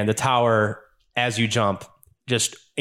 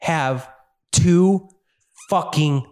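slow, higher, spaced-out threat; menacing ultimatum; 7 semitones higher, 3 dB louder, much slower than usual. Acted. Villain giving the party an ultimatum, slow and menacing. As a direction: hammer each word of the ultimatum. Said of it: "he says".

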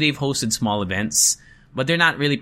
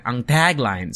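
"tagline" with an American flat a; American English accent; slower than usual. Shown as a demonstration. Host contrasting his own English, American accent. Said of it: "in my English accent for every English word".

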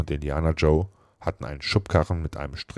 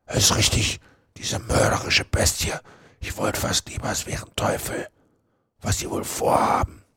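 intense stage whisper; 5 semitones higher than usual. A narrator's reading. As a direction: mutter it breathily from hiding. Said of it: "Mit dem Tuscheln".

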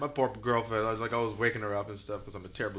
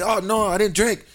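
put-on high, loud drunken denial; retold yell; 7 semitones higher, 9 dB louder than usual. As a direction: yell it like a drunk insisting on innocence. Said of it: "like, yelled at the back apparently".

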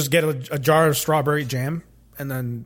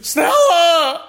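howling Stella, high and loud; Stella yell; 10 semitones higher, 4 dB louder than usual. Pretend. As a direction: bellow it like Brando in the street. Mock Streetcar yell in jam aisle.

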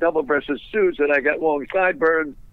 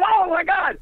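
shocked gasp. Retold or imitated. Board gasps at thrown ashtray.

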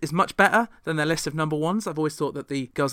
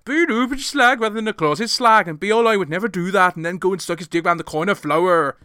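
put-on broad Geordie accent, higher and louder; 4 semitones higher, 5 dB louder than usual. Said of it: "she said it in her [name] voice".

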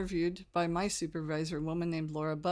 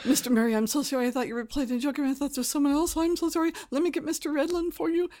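high, rushing mock-frantic apology; panicked apology; 8 semitones higher, 5 dB louder, faster than usual. Receptionist apologizing to the star she cursed, panicked babble. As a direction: babble apologies, pitch climbing.

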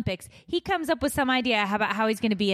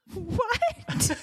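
disbelieving what; 11 semitones higher than usual. Retold or imitated. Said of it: "And I just was like".

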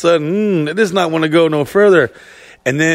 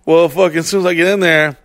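ordinary talk, nothing marked

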